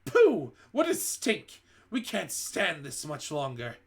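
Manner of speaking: very high, loud cry of disgust; disgusted cries. A narrator's reading. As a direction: shriek at the stink. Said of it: "cries of".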